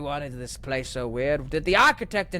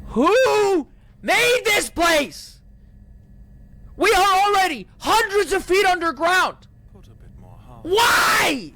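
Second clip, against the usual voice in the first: high, loud, very slow drawn-out shout; real frustrated scream; exasperated screaming; 5 dB louder, slower than usual. Real, not acted. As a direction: scream it into the chasm. Screaming at endless depth.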